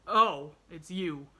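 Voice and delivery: loud "oh" falling off grudgingly; ungracious. A narrator's reading. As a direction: break off the outburst ungraciously. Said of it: "broke off not very graciously".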